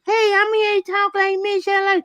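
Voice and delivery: extremely high put-on talking voice. Showing how the singer talks, in a very high voice. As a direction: talk way up high, mimicking the singer. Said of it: "'cause she talk like this".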